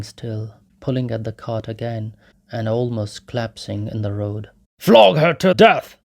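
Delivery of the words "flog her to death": loud, high, brutal yell; drunken shout. A narrator's reading. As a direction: bellow it at the crowd. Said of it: "shouted Mikolka".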